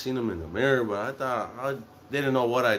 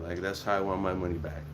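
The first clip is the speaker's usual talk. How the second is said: usual speaking voice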